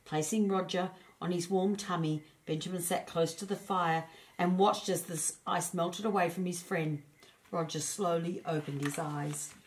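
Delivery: plain reading voice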